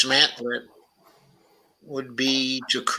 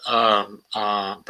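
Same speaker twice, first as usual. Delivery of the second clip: ordinary talk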